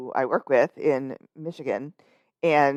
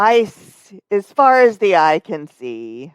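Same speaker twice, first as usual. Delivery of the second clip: mock dramatic; 4 semitones higher, 8 dB louder, slower than usual. Dramatic mock lament about ice.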